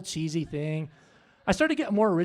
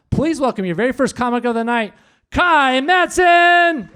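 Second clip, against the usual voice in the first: announcer introduction; 5 semitones higher, 11 dB louder than usual. Real, not acted. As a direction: bring the act on with a big roar. MC calls comic to the stage.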